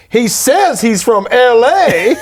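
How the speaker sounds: slow, laughing mechanic voice; a hint of a country mechanic. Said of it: "he's like".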